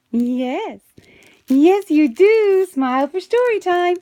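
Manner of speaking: high, loud playful coaxing; coaxing singsong. Real, not acted. Teacher coaxing children to smile, high loud singsong. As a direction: coax the little ones into smiling.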